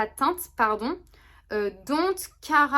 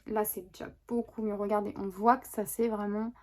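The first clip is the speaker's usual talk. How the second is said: ordinary delivery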